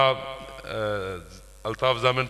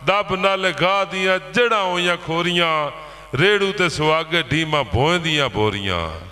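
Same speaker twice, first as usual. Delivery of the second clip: steady, rolling rhymed declamation; poetry recital; 7 semitones higher than usual. Acted. Poet recites ploughing verse to farmers, declaimed verse. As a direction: roll through the list in even metre.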